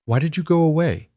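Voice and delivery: put-on high, hurt child's plea; voice cracking. A narrator's reading. Girl asks why the woman left, voice cracking. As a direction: ask in a high, wounded child's voice. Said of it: "Maddie's voice cracked".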